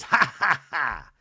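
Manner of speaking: mock belly laugh falling away; imitated laugh. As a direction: imitate a man cracking up. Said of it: "Andy even laughs at Mary".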